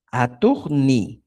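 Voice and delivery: "Aturni" slowly, with "ni" set apart; syllable by syllable. Shown as a demonstration. Teacher adding the auxiliary ni, syllable by syllable. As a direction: draw out "Aturni" part by part. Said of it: "el auxiliar ni".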